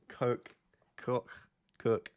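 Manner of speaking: shifting attempts at a Scouse "Coke"; trying out accents. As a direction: slide from "Coke" to "Cook", testing the accent. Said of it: "I don't know what accent".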